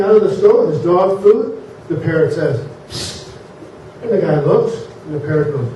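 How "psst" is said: short hissed "psst"; sneaky attention-getting psst; quick, quiet psst; parrot's psst. Acted. Parrot signals to passing customer.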